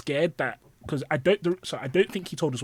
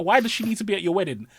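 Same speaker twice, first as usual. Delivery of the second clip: higher, louder incredulous telling-off; angry rant; 7 semitones higher, 6 dB louder than usual. Retold or imitated. Telling off a friend about inviting his ex, raised voice. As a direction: scold your friend, voice raised. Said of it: "I was so livid at him".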